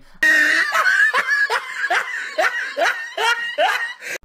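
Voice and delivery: shrieking howl of laughter; loud laughing fit. Real, not acted. Cracking up loudly at the wrestling clip, howling laugh. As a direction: burst out cackling at the clip. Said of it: "I'm still laughing".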